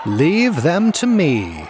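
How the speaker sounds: high, slow, loud jester's scream; shrill screaming jester. A narrator's reading. Jester Hop Frog screaming over the laughing crowd, shrill and loud. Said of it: "now screamed Hop Frog, his shrill voice".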